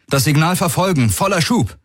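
urgent command, pitched high; calling out an order. A narrator's reading. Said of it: "rief Garland".

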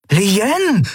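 high, sustained call of a name; high-pitched cry across the room. A narrator's reading. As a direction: call out the name in glad surprise. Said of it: "rief er".

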